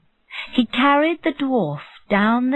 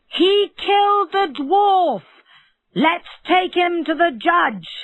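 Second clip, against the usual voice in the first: crowd shouting; 11 semitones higher than usual. Acted. Townspeople shout at the rich man, crowd yelling. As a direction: call it from the windows. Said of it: "looked out of their windows and shouted".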